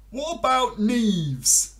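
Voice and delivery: high, loud, slow numbat voice; a big-voiced little numbat. Numbat asks frog, high and loud.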